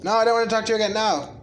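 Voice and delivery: loud annoyed yell at the screen; shouting. Real, not acted. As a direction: yell it out in frustration. Yelling at game character.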